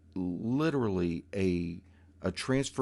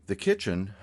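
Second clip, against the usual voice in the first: ordinary talk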